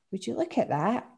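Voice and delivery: surprised, pitch rising; a wide-eyed exclamation at the bonfires; exclaiming. A narrator's reading. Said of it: "she exclaims".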